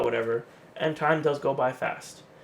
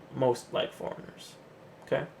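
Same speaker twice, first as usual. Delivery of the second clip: whispered aside; 7 dB quieter than usual. Real, not acted. Aside after a quiet remark.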